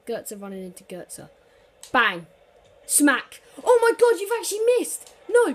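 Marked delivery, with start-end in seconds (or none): 1.9-5.5 screaming, much higher and louder; ecstatic yell. Real, not acted. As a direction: scream in relief at the miss. Opponent misses a chance.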